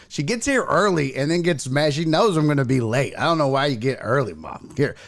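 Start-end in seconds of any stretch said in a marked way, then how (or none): none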